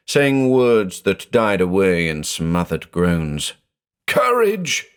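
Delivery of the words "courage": very high, urgent cry of encouragement; shriek. A narrator's reading. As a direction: shout to her through the dark. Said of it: "he shrieked".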